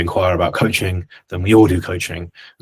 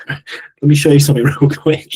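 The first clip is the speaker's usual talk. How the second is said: louder, laughing through the line; laughing mock offer; 6 semitones higher, 8 dB louder than usual. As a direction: offer help while cracking up. Retelling approaching gym guy.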